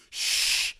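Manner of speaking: quick unvoiced shush. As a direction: hush someone sharply. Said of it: "they go".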